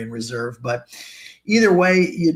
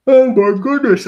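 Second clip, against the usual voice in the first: yawning; 10 semitones higher, 11 dB louder than usual. Real, not acted. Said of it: "I just yawned".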